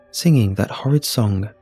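ordinary delivery, nothing marked

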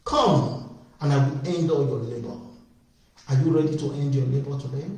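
usual speaking voice

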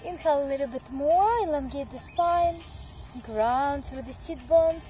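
calm, steady yoga teacher voice; guided yoga instruction. Acted. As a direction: lead the class through the pose. Leads a yoga practice.

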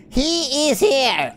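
ordinary delivery

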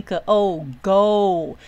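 "个O，go": consonant, then vowel, then joined as one long "go"; sounds blended. Shown as a demonstration. Teacher showing how two sounds join into one syllable, slowly blended "go". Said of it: "拼成一个音".

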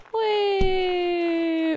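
drawn-out high "whee"; long squeal. Real, not acted. Player tumbling in the game, long squeal. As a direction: squeal and hold it.

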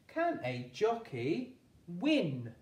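slow, each word spaced; slow rereading. Shown as a demonstration. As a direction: read back slowly while writing. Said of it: "rereading slowly while writing".

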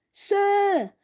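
high, loud eager call; pushy classmate shouting for sir. A narrator's reading. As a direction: yell for the teacher's attention. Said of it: "he shouts".

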